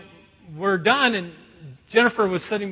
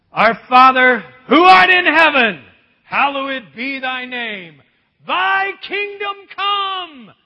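loud, high, slowing prayer recital; solemn prayer recital; 5 semitones higher, 11 dB louder, slower than usual. Retold or imitated. Pastor reciting the Lord's Prayer at a funeral, loud and slow. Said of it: "And then I started".

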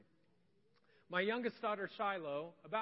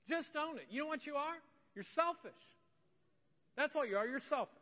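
scolding; 8 semitones higher than usual. Real, not acted. Scolding excuse-maker.

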